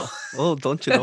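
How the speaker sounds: high, laughing mock Canadian accent with stretched oh. Host teasing the singer about her O's, laughing mock Canadian accent. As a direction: tease her with a Canadian-style oh, don't you know. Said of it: "got a little Canadian spritz in there".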